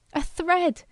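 high, excited shout. A narrator's reading. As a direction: blurt it out in triumph. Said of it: "one of the engineers shouted".

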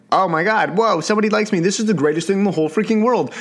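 hammed-up excited fan; mock giddy thrill. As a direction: play someone thrilled to be liked. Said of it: "this sounds like".

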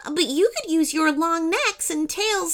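put-on high child's voice, pleading; earnest little girl. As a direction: explain the emergency as a polite child. Narrator plays Katie explaining.

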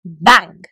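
loud mock bang. A narrator's reading. Narrator acts the spoon hitting the squirrel, loud bang. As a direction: punch out a sound effect. Comb hits gecko.

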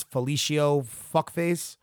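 ordinary delivery

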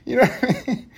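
high giggling laugh into the words; giggly high aside. Real, not acted. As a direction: laugh through the question. High laugh then question.

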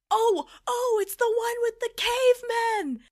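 high, loud excited blurt; acting out a frantic guess; excited yelling. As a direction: blurt it like you've just got it. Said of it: "I'm yelling and I'm screaming".

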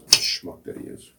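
unvoiced, slow jab under the breath; whispered aside. Real, not acted. Host whispering an insult about Fauci as an aside.

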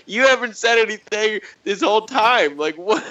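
high, incredulous mock rant; mock exasperation. Scolding the silent meme poster, high incredulous. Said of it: "It's like, dude".

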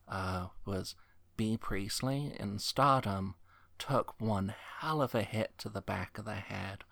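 ordinary delivery

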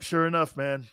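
ordinary delivery

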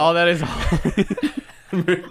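loud, high begging through laughter; exasperated high cry, laughing; laughing plea. Real, not acted. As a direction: plead at the top of your voice, cracking up. Begging friends to stop, cracking up.

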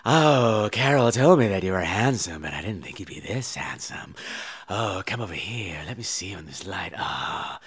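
low, hushed seductress; drunken mother's quiet come-on; seductive purr. Acted. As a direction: purr it softly and low, flirting. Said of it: "She starts trying to seduce the fiancé".

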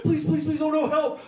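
high, panicky imitation of begging; mock terrified pleading. Doctor begging the patient for help with a name, mock terrified pleading. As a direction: plead like a hammed-up frightened doctor. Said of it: "the most terrified look in his eyes".

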